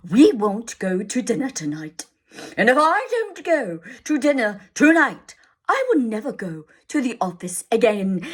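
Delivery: a stern father threatening ruin. Acted. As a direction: lecture her gravely. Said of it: "he went on sternly".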